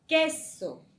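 "queso" with QUE hit hard and drawn out; teacher's demonstration of "queso", loud on QUE; stress on QUE. Shown as a demonstration. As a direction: punch the first syllable of "queso", let "so" drop. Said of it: "tiene la mayor fuerza de voz".

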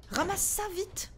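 panicking. Real, not acted. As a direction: rush it out in a panic. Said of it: "Je panique". (panique, plus aigu, pressé, puis éteint)

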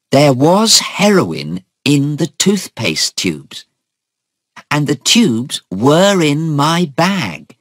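high, emphatic, drawn-out words; distressed protest. Acted. Daughter argues hopelessly with mother.

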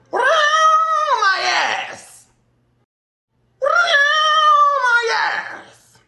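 high screaming shout; shrieking shout. Real, not acted. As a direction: screech it out high. Screaming outburst.

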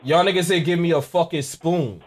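ordinary talk, nothing marked

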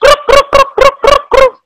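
high, repeated toad croaks. Shown as a demonstration. Teacher shows pupils how a toad sounds, croaking. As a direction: croak like a toad in quick bursts. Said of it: "Sound of a toad".

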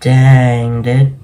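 ordinary talk, nothing marked

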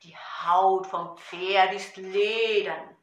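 loud rhythmic poem recital over a drum; Gedichtvortrag. Shown as a demonstration. (laut und rhythmisch zur Trommel vorgetragen)

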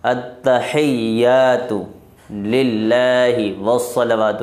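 slow, deliberate Arabic "اتحیات للہ والصلوات"; teacher reciting "والصلوات" slowly; careful recitation. Shown as a demonstration. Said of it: "تو اتحیات یہ صحیح کریں".

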